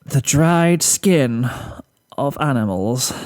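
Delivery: very slow, dreading each word; hesitant reveal. Acted. Rarity breaking the truth to Fluttershy, very slow and hesitant. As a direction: ease out the bad news slowly. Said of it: "She gulped".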